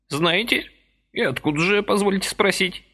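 put-on high, chirpy old man's voice; lively old man pressing a deal; brisk old man. A narrator's reading. Lively old man persuading Vasily, high chirpy voice.

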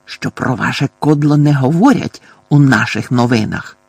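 usual speaking voice

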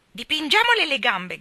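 put-on high, loud gleeful-boy cry; shouting. A narrator's reading. Gerald spots paint cans and shouts his plan. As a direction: call out the plan loudly. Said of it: "gridò Gerald".

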